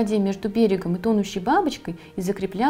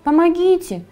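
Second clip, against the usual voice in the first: high, loud plea; acted cry of distress; crying for help; 9 semitones higher, 7 dB louder than usual. A narrator's reading. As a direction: call out desperately. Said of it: "снова закричала бедняжка".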